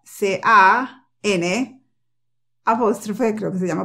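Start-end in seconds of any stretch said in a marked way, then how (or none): 0.0-1.8 letters spelled very slowly. Shown as a demonstration. Teacher spelling the negated word slowly. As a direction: spell each letter with long gaps. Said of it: "esa misma palabra negada".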